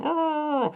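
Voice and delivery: very high held "aaah"; sustained high cry; imitated "Ah!" noise. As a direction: mimic the film effect on a high note. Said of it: "il y a eu le".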